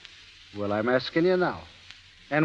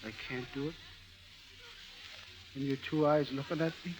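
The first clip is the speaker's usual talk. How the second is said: collapse into grief; quiet shattered lament; heartbroken; 8 dB quieter than usual. Acted. Broken lover gives up.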